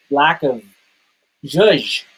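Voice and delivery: usual speaking voice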